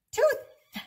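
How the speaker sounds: ordinary delivery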